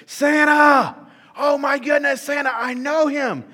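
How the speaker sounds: high, loud ecstatic shout; Buddy going nuts. Retold or imitated. Buddy the Elf hearing Santa is coming, ecstatic high shout. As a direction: scream it like an overjoyed kid meeting a hero. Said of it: "Buddy just goes nuts".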